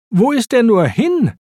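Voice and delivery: high-pitched, urgent questioning cry; calling out. A narrator's reading. Racer losing sight of the fleeing thief, calling out. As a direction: call it out, pitch jumping up. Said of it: "rief Lightning".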